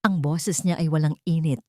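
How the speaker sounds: ordinary delivery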